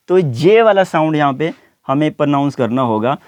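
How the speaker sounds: usual speaking voice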